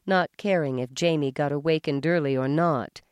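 ordinary delivery

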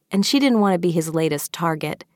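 ordinary delivery

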